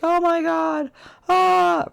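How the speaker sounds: high panicked shriek; scared kid scream. Retold or imitated. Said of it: "and they'd be like".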